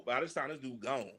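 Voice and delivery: ordinary talk, nothing marked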